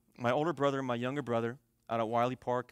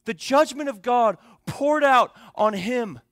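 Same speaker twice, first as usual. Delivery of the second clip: loud, high, drawn-out preaching; impassioned preaching; 8 semitones higher, 12 dB louder, slower than usual. Real, not acted. Preacher to congregation on the cross, loud and impassioned. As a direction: preach it at full force, hammering the key words.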